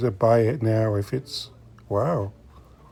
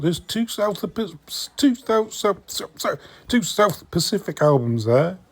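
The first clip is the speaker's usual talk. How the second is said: tongue-tied stumble; 7 semitones higher than usual. Real, not acted. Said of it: "Easy for me to say".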